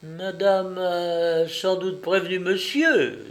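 timid servant's careful question. A narrator's reading. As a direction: ask timidly, drawing out the first words. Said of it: "demanda timidement la femme de chambre".

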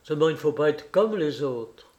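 ordinary delivery, nothing marked

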